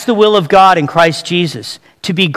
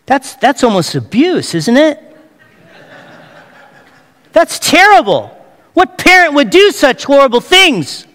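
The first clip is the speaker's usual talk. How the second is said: mock outrage; 6 semitones higher than usual. Mock outrage about the Mustang.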